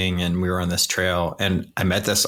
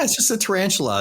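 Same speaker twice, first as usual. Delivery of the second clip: higher, fast, breezy shrug-off; mock dismissive; 11 semitones higher, much faster than usual. Telling the Aussie it's no big deal, mock dismissive. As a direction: brush it off like nothing. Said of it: "And I'm like".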